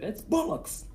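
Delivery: a cartoon English accent for the laugh; mock English accent. Friend asks for an English accent, hammed-up English. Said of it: "Can you say it with an English accent?".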